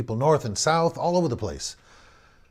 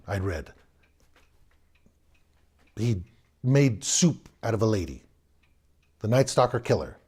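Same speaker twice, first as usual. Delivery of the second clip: ordinary delivery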